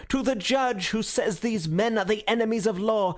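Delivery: high, ringing denunciation in mounting phrases; thundering speech. A narrator's reading. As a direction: declaim pitilessly to a roused crowd. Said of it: "speaking in a peal of pitiless thunder".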